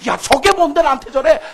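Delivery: loud, high angry shout; angry outburst. Acted. Preacher voicing a man provoked by a nobody, shouting angrily. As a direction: bark it out like a man insulted.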